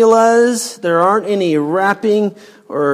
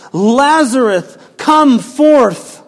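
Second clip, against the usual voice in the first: called out loud and higher, slow and commanding; loud cry; 6 semitones higher, 7 dB louder, slower than usual. Acted. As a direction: cry out the command so the dead can hear. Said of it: "he cried out with a loud voice".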